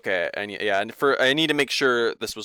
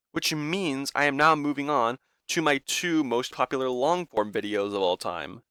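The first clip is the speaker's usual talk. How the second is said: ordinary delivery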